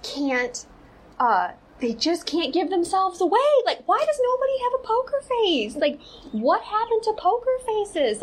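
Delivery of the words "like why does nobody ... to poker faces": much higher, incredulous rant; exasperated disbelief. Real, not acted. Vlogger ranting that nobody has a poker face, exasperated disbelief. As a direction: rhetorical questions pitched way up in exasperation. Exasperated at people giving themselves away.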